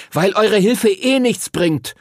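put-on high, heated boy's retort; snapping. A narrator's reading. Henry rejects his friends' help, high angry snapping. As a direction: push each word out high and slowly. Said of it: "schnappte Henry".

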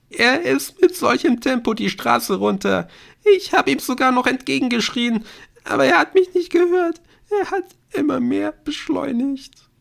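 put-on very high, frantic girl's voice; hysterical, tearful recounting; distraught confession. A narrator's reading. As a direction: pour it out in high-pitched distress. Distraught girl confesses the accident.

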